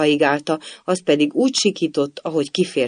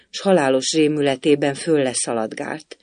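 plain reading voice

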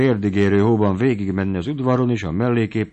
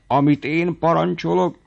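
outraged; 9 semitones higher than usual. A narrator's reading. Squire outraged at refusal.

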